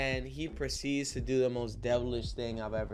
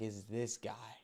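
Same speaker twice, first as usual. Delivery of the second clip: much softer, sly reveal; soft gloating; 5 semitones lower, 11 dB quieter, slower than usual. Retold or imitated. Mark showing the boyfriend's photo, soft sly reveal. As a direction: murmur it with a grin. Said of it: "He goes like".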